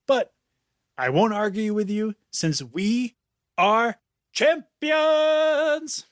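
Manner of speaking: high, drawn-out victory cry on champions; gloating triumph. A narrator's reading. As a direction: stretch champions out like a winner's chant. Vale gloating, celebrating victory.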